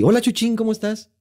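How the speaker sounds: put-on high sing-song hello to a kid; exaggerated happy hello; mock cheery greeting. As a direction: greet him high and bright like a friendly adult.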